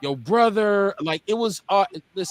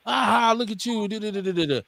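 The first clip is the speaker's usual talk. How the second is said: mock taunting; 4 semitones higher than usual. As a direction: imitate a playground bully jeering. Imitates a taunting bully.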